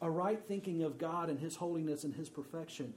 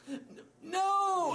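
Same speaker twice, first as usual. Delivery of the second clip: put-on higher, louder stammering child voice; crying toddler; 6 dB louder than usual. Daughter confessing to dad: imitated crying child. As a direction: stutter through tears like a little girl.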